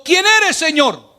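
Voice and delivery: trembling and fearful. Acted. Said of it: "él temblando y temeroso".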